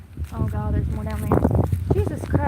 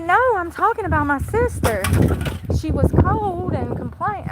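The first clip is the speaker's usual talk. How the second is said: higher voice imitating the woman; imitating her; 7 semitones higher than usual. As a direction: raise the pitch to play her politely explaining. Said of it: "she said".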